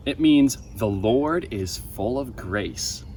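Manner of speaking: usual speaking voice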